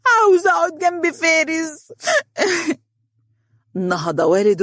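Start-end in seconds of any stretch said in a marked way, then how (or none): 0.1-1.9 put-on very high, tearful child whine; crying little girl. A narrator's reading. Little girl clinging to Faris, crying in a high voice. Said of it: "وقالت ببكاء". 2.0-2.7 put-on high childlike sob; crying. A narrator's reading. Little girl clinging to Faris, high sobbing. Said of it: "وقالت ببكاء".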